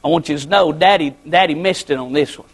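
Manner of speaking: put-on low, hushed apology to children; imitating a sorry daddy, voice dropped low; humble fatherly apology. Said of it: "Get down on their level and say".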